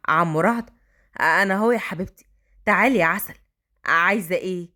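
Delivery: put-on a grown man talking kindly to a child; gentle man voice. A narrator's reading. Brother teases her.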